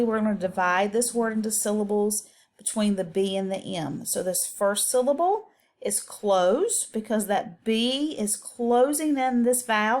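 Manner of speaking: ordinary talk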